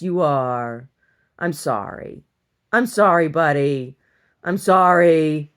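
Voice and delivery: slow, soothing sorry to the dog; tender apology. Real, not acted. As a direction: apologize gently.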